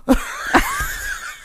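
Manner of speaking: shrieking burst of laughter; high squeal laugh. Real, not acted. Bursts out laughing mid-sentence.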